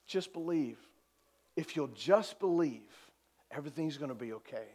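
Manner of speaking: soft, dropping to low and hushed; gentle reassurance. Acted. Jesus urging the worried to just believe, soft and reassuring. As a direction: ease down into quiet comfort.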